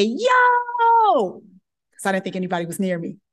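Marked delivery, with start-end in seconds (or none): 0.0-1.4 high, loud triumphant yo; gleeful yo. Retold or imitated. Intern calling her senior resident, loud triumphant yo. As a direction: shout it like you won.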